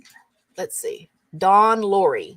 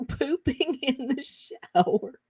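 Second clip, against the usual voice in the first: slow, higher, laughing through the words; cracking up; 4 semitones higher, 5 dB quieter, slower than usual. Real, not acted. Host repeating a friend's typo, cracking up. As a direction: savour the silly phrase through giggles.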